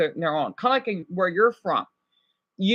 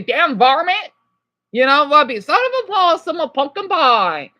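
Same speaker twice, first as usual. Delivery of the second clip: mock Southern accent; 5 semitones higher, 4 dB louder than usual. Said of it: "I love how Southern people talk".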